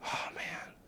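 breathy whispered disappointment; whispered letdown. Retold or imitated. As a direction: let it out on breath, defeated. Discouraged sigh.

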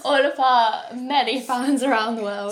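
loud, laughing announcement; laughing shout-out. Real, not acted.

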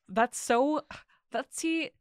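loud, high rattled outburst; spooked and worked up. Real, not acted. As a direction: blurt it out, freaked out. Reacting scared to the story.

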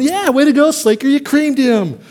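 a cheering teammate congratulating; mock cheer. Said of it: "You're going".

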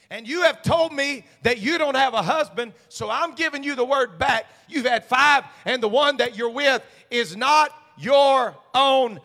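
loud, high fervent preaching; full-throated pulpit cry. Acted. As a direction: shout it out like a revival preacher.

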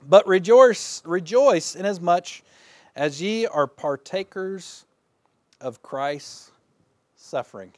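solemn recitation; scripture reading. Acted. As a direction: proclaim the verse. Reads scripture.

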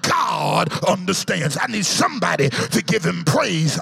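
normal talking voice

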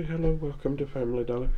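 friendly monotone cashier. Retold or imitated. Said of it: "talk to 'em in a monotone and be friendly".

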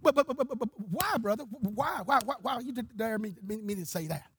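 imitating a stammered, bewildered protest; Brother Buddy's stutter. Stuttering evangelist answers the angry preachers, stammered protest. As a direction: stick on each word, softening as it goes. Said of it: "he stuttered".

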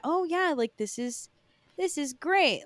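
mock-pleased, lightly put on; feigned enthusiasm. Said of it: "I'm so bad at, like, pretending".

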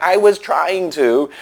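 slow, high put-on exasperation; exasperated neighbor. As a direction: drag it out, fed up. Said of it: "my neighbor's like".

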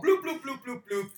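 high-pitched electronic blooping; playful mouth-made machine beeps; imitated button bloops. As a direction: rattle off little rising-and-falling bloops. Imitates the buttons being pushed.

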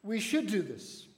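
should leaned on with a falling tune; stress on should. Shown as a demonstration. Pastor presses should to urge the congregation to act. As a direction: press hard on the word should. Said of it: "emphasize the word should".

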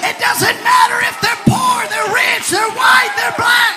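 loud, high, shouted sermon climax; full-force preaching. Real, not acted. Preacher urges the church to invite anyone, shouting fervently. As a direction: belt it out to rouse the congregation.

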